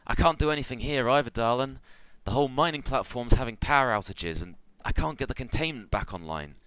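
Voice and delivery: desperate hobgoblin. Acted. Hobgoblin engineer to the shaman amid power outages, desperate. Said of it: "desperately pulling at levers".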